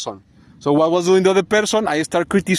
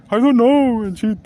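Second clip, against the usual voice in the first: higher-pitched imitated cluelessness; mock excuse; 6 semitones higher than usual. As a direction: mimic a lame excuse, pitched up. Mimics the other person's excuse.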